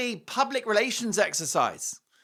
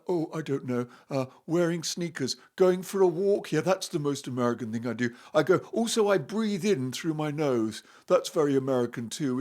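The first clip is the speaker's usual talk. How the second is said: mock American accent; 5 semitones lower than usual. Mocking Harry's answers to the host in a put-on American accent. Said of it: "Trying to do an American accent".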